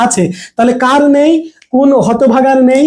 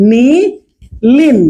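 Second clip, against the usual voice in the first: নীলিন stretched very slow; drawn out word; much slower than usual. Shown as a demonstration. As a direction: hold নীলিন long for emphasis. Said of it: "বলা হয় নীলিন". (নীলিন শব্দটা টেনে টেনে বলা)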